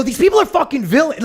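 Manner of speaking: high-pitched hammed-up outrage; pretend really mad. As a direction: act furious for comic effect. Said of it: "you'd act really mad".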